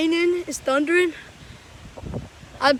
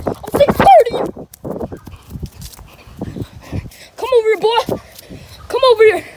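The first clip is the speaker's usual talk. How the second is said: higher, louder taunting calls; excited taunt; 7 semitones higher, 5 dB louder than usual. Real, not acted. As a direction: goad the runner, hyped up. Taunting while chasing.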